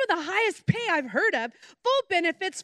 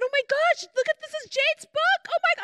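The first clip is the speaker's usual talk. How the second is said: high, breathless thrill at finding the book; excited gasp; 10 semitones higher, 3 dB louder than usual. Retold or imitated. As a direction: squeal with delight over a treasured book. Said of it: "I got excited".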